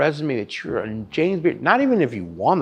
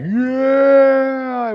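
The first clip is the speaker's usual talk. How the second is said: much higher, louder victory shout; cheering yell; 7 dB louder than usual. Retold or imitated. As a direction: cheer like you just won. Celebrating being a finalist.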